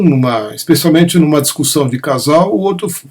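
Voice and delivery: normal talking voice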